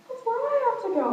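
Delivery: high, loud delighted squeal; excited squeal. Retold or imitated. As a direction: squeal it with glee. Retold chat, excited reply.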